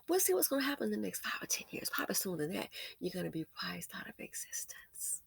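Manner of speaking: very soft, whispered warning; hushed, almost voiceless aside; ominous near-whisper. Real, not acted. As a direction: drop to a whisper as a grim prediction.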